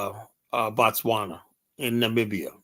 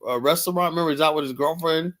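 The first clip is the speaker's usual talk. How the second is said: ordinary talk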